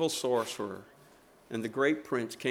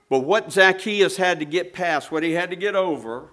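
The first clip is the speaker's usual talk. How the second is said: rising, louder preacher's insistence; preaching with force; 5 dB louder than usual. Acted. Preacher insisting to his congregation, louder and rising. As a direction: drive the point home to the congregation.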